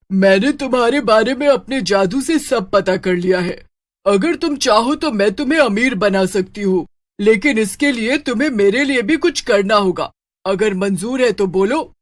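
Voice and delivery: put-on witch voice making an offer; bargaining witch. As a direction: witch tempting a poor boy with a deal.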